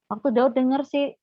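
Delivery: normal talking voice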